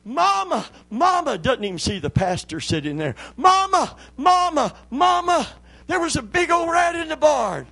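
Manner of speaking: put-on high, loud, breathless child yelling; excited little boy. Boy running in to tell his mama about the rat, shouting high. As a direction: burst in shouting like an eager kid. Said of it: "the little boy comes running in".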